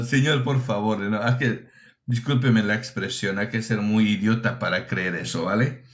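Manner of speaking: ordinary talk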